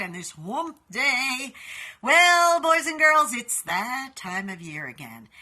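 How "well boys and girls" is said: put-on high, loud sing-song call to the kids; playful call. Host greeting her viewers with a loud, playful call. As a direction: hail the audience like a children's show host.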